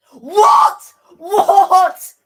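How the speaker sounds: real outrage at the call; shocked shout. Real, not acted. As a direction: yell it in total disbelief. Penalty given shock.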